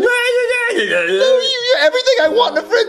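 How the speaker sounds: tearful, pleading wail; crying. Acted. Boy to his only friend as he dies, crying. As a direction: sob out a last farewell. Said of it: "stop crying".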